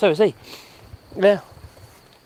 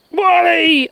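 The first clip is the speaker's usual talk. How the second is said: higher-pitched call; 7 semitones higher than usual. Real, not acted. Streamer calling to an animal in the garden, high call.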